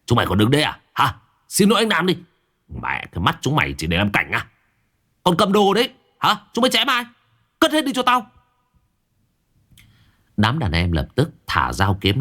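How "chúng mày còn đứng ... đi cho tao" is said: scarred thug boss bawls out his men; barking at underlings. A narrator's reading. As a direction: bark orders at the thugs. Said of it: "gằn giọng quát mấy thằng đệ".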